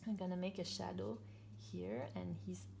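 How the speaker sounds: ordinary delivery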